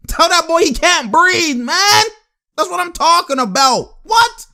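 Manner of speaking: high-pitched fan hype, punching each phrase; fired-up fan; hyped-up bragging. Real, not acted. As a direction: crow over your player's dominance. Hyped over dominant play.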